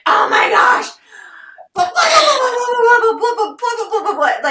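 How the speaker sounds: high, loud, rapid-fire rant spilling over; frantic venting. Retold or imitated. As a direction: vent it all out in one breathless burst of high energy. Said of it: "my tone of voice and my, like, high energy".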